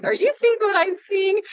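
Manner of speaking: high, knowing quote to husband; high-pitched retold side-eye remark; side-eye question. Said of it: "giving Matt a little side eye".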